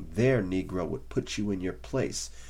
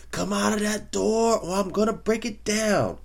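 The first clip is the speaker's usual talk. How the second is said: high, loud yelled challenge; shouting taunt; 14 semitones higher, 7 dB louder than usual. Retold or imitated. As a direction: bellow it at the front door. Clay taunting Liston at his door.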